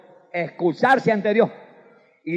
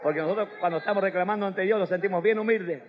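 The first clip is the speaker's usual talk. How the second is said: ordinary talk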